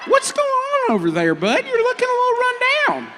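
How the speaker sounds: high, folksy prospector voice; wild prospector. Acted. Crewman asking the gray man what's wrong, wild prospector voice. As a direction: quiz him like a wild prospector. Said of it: "we decided on Wild Prospector".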